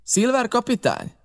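the captain mocking the pirate's self-given title; scornful disbelief. A narrator's reading. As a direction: throw the title back in mockery. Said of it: "kiáltotta vissza a kapitány".

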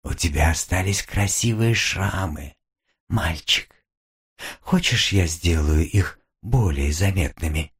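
low, hushed demon woman; a mocking demon, low and soft. Demon woman threatens playfully. (низкий голос насмешливого демона)

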